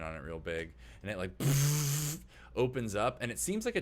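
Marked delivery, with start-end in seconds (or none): 1.4-2.2 noisy mouth-made door sound; imitated door whoosh. Game master showing the brig blast door open, imitated whoosh. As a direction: make the noise of a big door opening.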